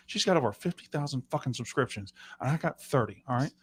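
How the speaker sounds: low, quiet aside to the producer; mock off-mic scolding. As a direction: grumble it softly away from the mic in mock complaint.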